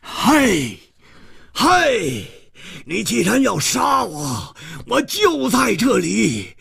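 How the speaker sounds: high, drawn-out furious bellow; angry roar. Acted. Hero calling after his vanished attacker, furious roar. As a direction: roar each phrase out slowly in anger. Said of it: "胡铁花怒吼道".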